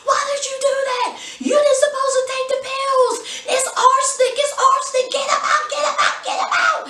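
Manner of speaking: high frantic screaming, far up in pitch; mock panicked yelling about poison; panicked shriek. Valinell panics over the pills.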